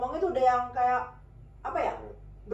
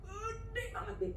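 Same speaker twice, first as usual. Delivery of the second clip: awed; 11 semitones higher, 9 dB quieter, slower than usual. Real, not acted. Awed at the huge snake.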